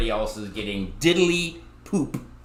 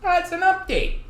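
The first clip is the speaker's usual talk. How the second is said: loud, high "That's an update" called out like a game-show host; hyped announcement; 6 dB louder than usual. Shown as a demonstration. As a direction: shout "That's an update" as a big reveal. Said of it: "loud high announcer take of same line".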